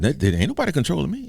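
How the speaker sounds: put-on high, hurried boy's denial; protesting grandson. Grandson denies to granddaddy he is controlled, protesting. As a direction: blurt it out fast like a defensive kid.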